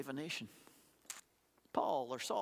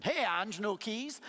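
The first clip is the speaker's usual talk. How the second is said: playful, louder call across the hall; silly teasing call; 4 semitones higher, 4 dB louder, much slower than usual. Retold or imitated. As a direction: tease a friend who forgot her keys. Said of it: "I'm being kinda silly".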